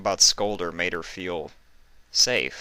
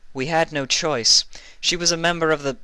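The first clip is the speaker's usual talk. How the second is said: explaining; 10 semitones higher, 3 dB louder than usual. Acted.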